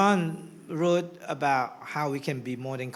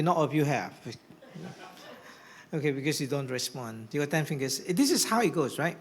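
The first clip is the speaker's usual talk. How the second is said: normal talking voice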